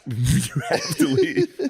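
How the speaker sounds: high, slow words squeezed through laughter; can't stop laughing while quoting. Retold or imitated. Quoting the professor, high and laughing slowly. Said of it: "the professor was like".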